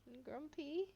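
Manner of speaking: asking the cat softly if he is grumpy, tender coo. Real, not acted. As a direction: coo it gently with a rise. Teasing the sleepy cat.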